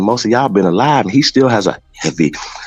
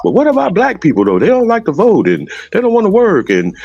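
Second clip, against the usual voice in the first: high, louder whiny mock talking points; mocking a host, pitched up and whiny; mocking deflection; 12 semitones higher, 3 dB louder than usual. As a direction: parody a pundit changing the subject. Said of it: "He keep trying to deflect".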